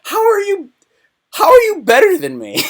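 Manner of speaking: high, squeaky put-on voice of a baffled worker; whiny employee. Worker asking the boss, squeaky and high. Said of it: "that weird question like".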